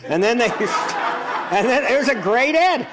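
laughing high through the words. Real, not acted. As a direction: chuckle while telling it. Laughs recalling the funny ad.

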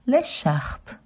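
l'écharpe as lay-sharp, throaty r; French pronunciation. Shown as a demonstration. Teaching children l'écharpe. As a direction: pronounce l'écharpe slowly in French. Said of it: "Scarf in French is".

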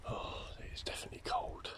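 hushed whisper. Real, not acted. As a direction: whisper it under breath. Whispering in cold graveyard.

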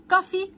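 كافي begins with a hard k. Shown as a demonstration. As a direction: open كافي with a clean k sound. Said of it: "السي هنا بتتنطق كأنها ساوند الكي". (كافي بصوت الكاف)

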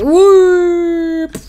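long high, loud whoop; loud sliding whoop noise; comic whoop. Acted. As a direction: draw out a cartoon "whoop". Imitates character falling.

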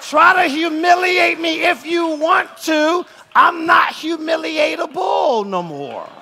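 loud, impassioned sermon shout; full-force preaching. Real, not acted. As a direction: build to a proud, defiant declaration from the pulpit.

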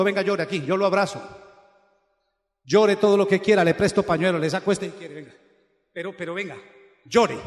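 tender offer of a shoulder; comforting invitation. Retold or imitated. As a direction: coax them to lean in and cry. Preacher voices comforting a crier.

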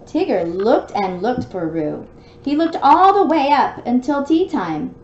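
lively read-aloud storybook voice; story narration. A narrator's reading. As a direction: read it out like bedtime for a little one. Reads storybook to child.

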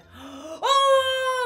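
high shouted gasp; loud shocked gasp. Real, not acted. Filter transformation shock.

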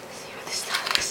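soft breathy whisper. Real, not acted. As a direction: whisper it in awe. Whispers while showing stylus.

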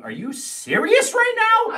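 high, slow disbelieving voice; incredulous wife. Retold or imitated. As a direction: play the shocked wife. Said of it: "London's looking at me going".

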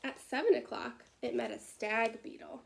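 usual speaking voice

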